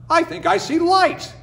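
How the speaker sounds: high, eager voice of the coyote man; coyote man to himself. Acted. Coyote man spots light in the sky, high and eager. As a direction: peer up hopefully. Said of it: "said Oye to himself".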